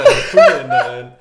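slow, loud, laughing through it; laughing while speaking. Real, not acted.

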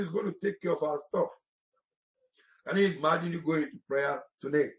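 normal talking voice

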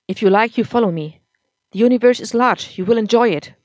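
put-on loud, bitter drunk husband; drunk angry husband. A narrator's reading.

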